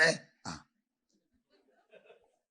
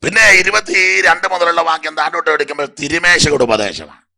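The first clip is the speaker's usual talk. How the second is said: preaching at full force; 4 semitones higher, 12 dB louder than usual. Real, not acted. Preacher announces new passage.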